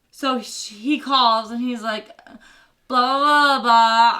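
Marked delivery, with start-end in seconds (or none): 2.9-4.2 loud, filler babble; booming mock blather; imitated phone chatter. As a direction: fill in his call with loud nonsense. Concierge's phone call imitated.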